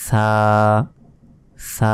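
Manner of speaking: সা as a lisped "thaa", tongue between the teeth; drawn-out Arabic tha in সা. Shown as a demonstration. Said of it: "জিহবা বের করে".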